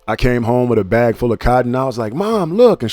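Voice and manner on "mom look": put-on lightly higher little-kid voice, showing off; a hint of an excited child; imitating kid self. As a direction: play your small self proudly holding up cotton. Kid self quoted to mom.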